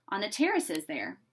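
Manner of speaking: ordinary delivery, nothing marked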